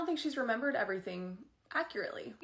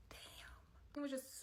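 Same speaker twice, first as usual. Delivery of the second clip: whisper; 5 semitones higher, 12 dB quieter, much slower than usual. Real, not acted. Breathless reaction.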